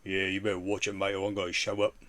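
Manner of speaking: lightly voiced London tough; a hint of a Cockney menace; mock Cockney threat. Streamer imitating Bizzle threatening him, mild Cockney. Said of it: "an angry Bizzle at the door".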